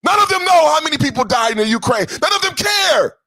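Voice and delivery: high, loud, breaking into a shout; emotional outburst. Real, not acted. Host erupting at viewers who joke about war deaths, shouting. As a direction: let it boil over into a furious shout. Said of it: "I'm getting emotional".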